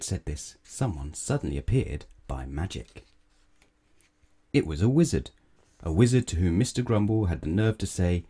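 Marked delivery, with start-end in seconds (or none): none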